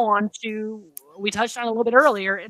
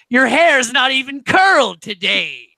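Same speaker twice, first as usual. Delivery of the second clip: higher, incredulous accusation; mock indignant; 6 semitones higher, 4 dB louder than usual. Housemate challenging the curling iron alibi, mock indignant. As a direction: imitate someone catching out a lie.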